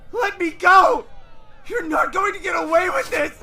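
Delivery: high, loud enraged yelling; furious shout. Acted. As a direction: scream at the captors. Captured boy fights back.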